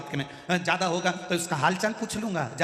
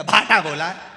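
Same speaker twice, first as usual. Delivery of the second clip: irritated retort; 9 dB louder than usual. Retold or imitated.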